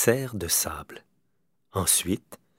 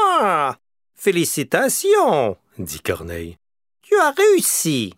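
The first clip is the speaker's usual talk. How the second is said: much higher, louder congratulations; crow cheering; 8 semitones higher, 5 dB louder than usual. A narrator's reading. Crow congratulating the bear on his climb, high and excited. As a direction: burst out with joy.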